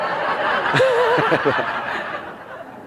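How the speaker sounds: airy high-pitched laugh bursting out; real unvoiced giggle at the joke; breathless high laugh. Real, not acted. Laughing at own story.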